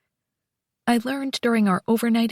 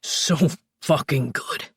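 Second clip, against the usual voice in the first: slow deep groan of words; low slow lover voice; low male voice; 8 semitones lower, slower than usual. Acted. Luca voiced.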